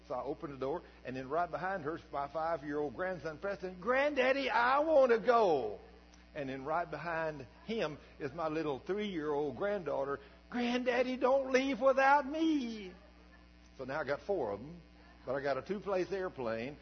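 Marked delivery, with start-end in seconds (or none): 3.8-5.8 put-on higher, louder, slow child's call; eager grandson. Five-year-old grandson begs granddaddy to take him flying, eager child call. 10.5-12.9 put-on higher, louder, slow pleading child; little granddaughter. Three-year-old begs granddaddy not to leave without her, pleading.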